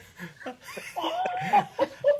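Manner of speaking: high burst of laughter; real laughter. Real, not acted. Group cracking up at the punchline of the truck story, real laughter.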